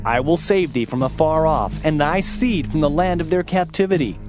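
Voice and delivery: ordinary delivery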